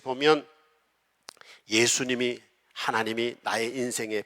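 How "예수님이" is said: ordinary talk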